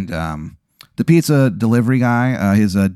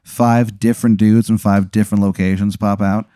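ordinary delivery

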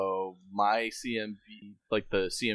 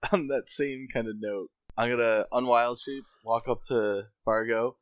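ordinary talk, nothing marked

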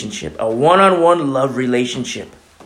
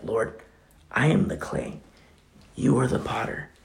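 humble prayer; 3 semitones lower, 7 dB quieter than usual. Pretend.

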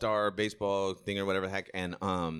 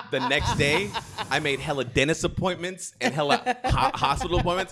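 raised, loud voice through laughter; laughing confession; 6 semitones higher, 7 dB louder than usual. Real, not acted. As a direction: tell it loudly while cracking up.

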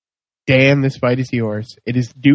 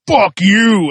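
raised, punchy acted curse; Dante's angry retort; 6 semitones higher, 4 dB louder than usual. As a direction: throw the insult back hard. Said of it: "Dante gets up and is like".

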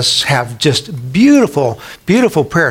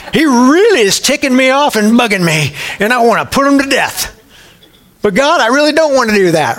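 higher, hammed-up exasperated prayer, a laugh breaking through; Luther's honest prayer; 8 semitones higher than usual. Preacher voicing Luther's blunt prayer to God, hammed-up and high. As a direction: pray like a fed-up man who means every word. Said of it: "Here's Luther".

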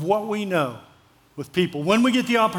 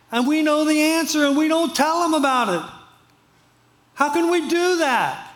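rising, higher preaching appeal; 7 semitones higher than usual. Real, not acted. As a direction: lift the congregation with a pleading sermon.

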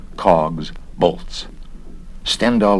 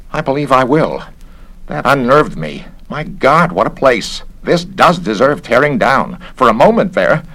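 higher, rattled character voice; unnerved investigator; 6 semitones higher, 4 dB louder than usual. A narrator's reading. Garrett takes a drink after a fright, rattled. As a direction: play a fussy official who has been shaken.

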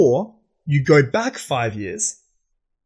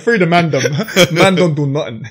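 loud, mock London roadman; roadman accent; 3 semitones higher, 6 dB louder, slower than usual. Shown as a demonstration. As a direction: put on a roadman accent for laughs, pitched up a little. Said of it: "you also have the roadman accent".

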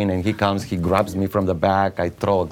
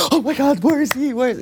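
very high starstruck fan squeal; hammed-up excited fan. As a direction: go gaga, pitched way up. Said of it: "we have to get starstruck".

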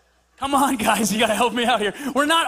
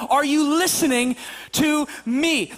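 "listening to me" slow and separated; emphatic word by word; slower than usual. Shown as a demonstration. Parent making sure child hears, "listening to me" slowly emphatic.

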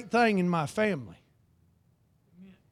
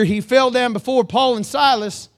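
normal talking voice

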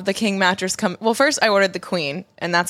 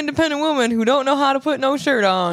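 higher, hammed-up sassy diva; sassy strong woman voice; 6 semitones higher than usual. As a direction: play the proud independent woman. Mock strong woman speech.

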